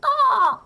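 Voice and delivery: piercing high eagle screech; loud, sharp mock eagle cry; golden eagle screech. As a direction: shriek like a bird of prey. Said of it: "my attempt at a golden eagle".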